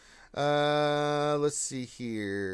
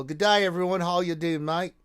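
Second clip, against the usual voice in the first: thick, hammed-up Aussie greeting; loud, higher Australian accent; 5 semitones higher, 5 dB louder than usual. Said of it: "Thickest Australian accent possible".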